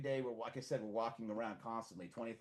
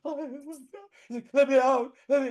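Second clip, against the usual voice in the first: much higher and louder, begging; mock panicked plea; 13 semitones higher, 14 dB louder than usual. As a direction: beg to be let off. Scared rider begging.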